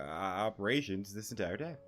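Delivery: ordinary talk, nothing marked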